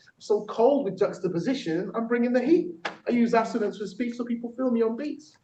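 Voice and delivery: lifted, rhythmic rap-style delivery; poem performance. Acted. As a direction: perform it playfully like a rapper. Performs own technique poem.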